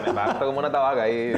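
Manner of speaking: laughing through the words; cracking up while saying it. Real, not acted. Cracking up at his joke.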